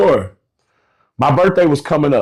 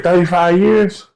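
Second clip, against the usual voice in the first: slow, drawn-out incredulous question; retold disbelief; 2 semitones higher than usual. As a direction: stretch it out in mild disbelief. Retold disbelief at coworker's long service.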